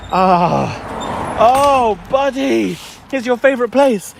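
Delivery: high, eager voice for the dog; excited talk to dog. Real, not acted. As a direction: get the dog excited, pitch up. Talking excitedly to his dog.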